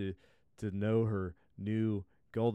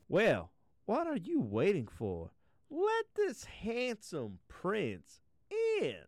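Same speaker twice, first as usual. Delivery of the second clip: queen voice; 11 semitones higher, slower than usual. Acted. Queen urges princess.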